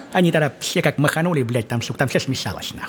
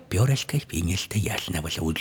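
low, soft mock answer; youngest daughter; 8 semitones lower, 7 dB quieter than usual. The youngest daughter asks for the feather, low soft mock voice. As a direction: mumble the wish quietly. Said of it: "youngest daughter answers".

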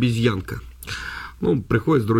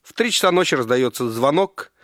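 ordinary delivery

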